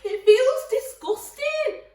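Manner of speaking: put-on high, whining child protesting to mum; whiny kid. Child whining to mum about touching raw meat, high and pleading. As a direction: squeal it like a fussy little kid.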